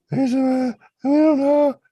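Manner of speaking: high, loud panicked shriek; frantic kids shrieking to neighbors; hysterical scream. Retold or imitated. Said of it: "screaming hysterically now".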